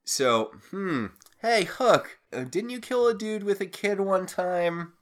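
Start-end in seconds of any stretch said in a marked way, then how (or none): 1.4-4.9 high-pitched mock casual question; lightly hammed-up, higher voice; mock calling out. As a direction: call over in a higher, put-on voice. Voicing a casual question to Hook.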